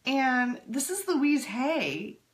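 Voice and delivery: ordinary delivery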